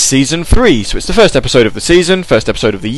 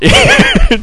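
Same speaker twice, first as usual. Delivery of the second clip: high laugh. Real, not acted. Laughs at lottery joke.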